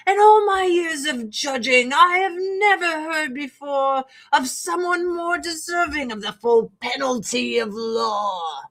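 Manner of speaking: hammed-up rock opera judge, big swings up and down; theatrical judge. Singing alone at home, playing the judge theatrically. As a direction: belt it out like the judge on a stage.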